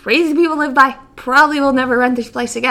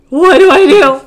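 panicked; 4 semitones higher, 7 dB louder than usual. Retold or imitated. Chipmunk loose in house, panicking.

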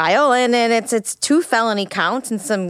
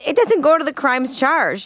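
ordinary delivery

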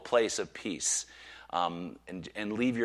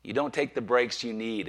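usual speaking voice

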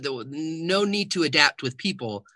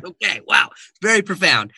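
loud, high mock amazement; sarcastic; 5 semitones higher, 9 dB louder than usual. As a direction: gush with fake wonder. Mock awe at the owner.

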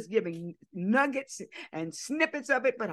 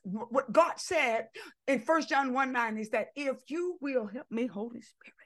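ordinary talk, nothing marked

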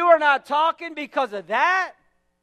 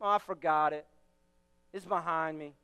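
much lower, softer dismissive claim; mock denial; 10 semitones lower, 7 dB quieter than usual. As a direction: brush it off low and quiet, unconvincing. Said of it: "we don't even wanna admit".

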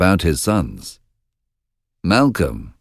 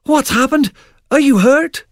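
put-on much higher, urgent young voice; excited young son. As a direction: call to father in alarm. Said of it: "he cried".

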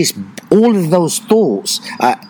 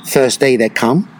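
ordinary talk, nothing marked

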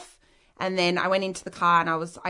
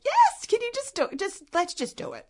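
very high-pitched, pleading mock exasperation; exasperated insisting; 13 semitones higher than usual. Daughter pushes mum to just do it, exasperated. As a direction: act out begging a reluctant parent to cooperate.

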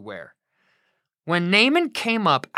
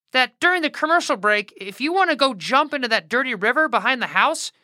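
high, quick, flustered servant voice; jittery high-pitched messenger, fast; nervous servant; 7 semitones higher than usual. Acted. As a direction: rush the message out and flee. Servant delivers Elisha's message.

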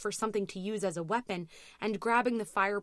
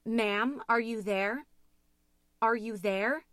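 raised dispatcher voice, rising at the end; repeated urgent question; 4 semitones higher, 6 dB louder than usual. A narrator's reading. Dispatcher getting no answer from Louise, urgent. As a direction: call out anxiously down the line. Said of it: "asking her the same question over and over".

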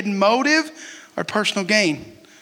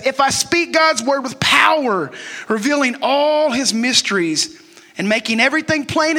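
forceful preaching; 4 semitones higher than usual. Acted.

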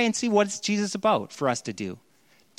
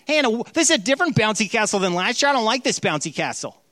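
whiny entitled visitor; 8 semitones higher than usual. Retold or imitated. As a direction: mimic a spoiled regular griping about freebies. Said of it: "coming back year after year like".

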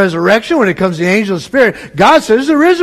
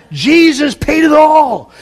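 proclaimed hymn; 6 semitones higher, slower than usual. Real, not acted. Preacher proclaims hymn line loudly.

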